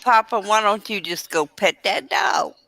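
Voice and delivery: ordinary talk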